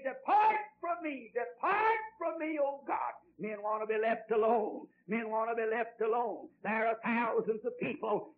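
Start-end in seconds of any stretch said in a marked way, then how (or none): 0.0-3.1 high-pitched cry pushing God away; acted rejection. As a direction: call it out as defiant sinners. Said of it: "they say".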